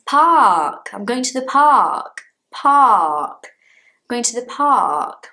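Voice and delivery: "park" with long falling open vowel, R silent; British park. Shown as a demonstration. Teacher modelling for learners, drawn-out "park" with silent R. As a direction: stretch "park" into "paak", a little loud. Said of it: "Not park".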